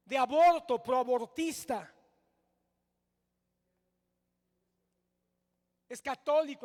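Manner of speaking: loud, indignant pulpit denunciation; preacher denouncing a politician to the congregation, loud and heated; heated loud preaching. Acted. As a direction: rail against it from the pulpit, loud. Preaching at full force.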